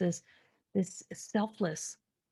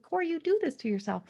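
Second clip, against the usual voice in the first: self-scolding; 7 semitones higher, faster than usual. Retold or imitated. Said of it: "And I'm like".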